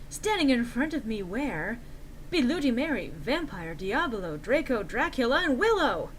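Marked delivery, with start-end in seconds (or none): none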